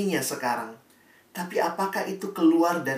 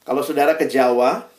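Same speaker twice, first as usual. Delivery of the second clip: ordinary delivery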